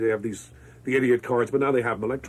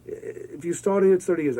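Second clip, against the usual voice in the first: sheepish writer; 6 semitones higher than usual. Retold or imitated. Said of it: "the writer comes out very sheepishly".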